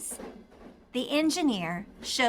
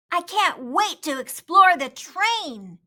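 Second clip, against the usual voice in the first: put-on excited boy; 5 semitones higher, 6 dB louder than usual. A narrator's reading.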